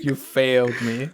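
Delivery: slow, hammed-up accusation; mock betrayed. Playing along as the let-down friend, mock betrayed. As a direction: draw it out in mock disappointment. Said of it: "you feel almost betrayed by this individual".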